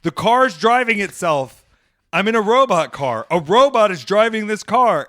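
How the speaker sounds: showing off to everyone outside the robot car; gleeful announcing. Retold or imitated. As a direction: call out to the street like a delighted tour guide. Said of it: "I'd be like".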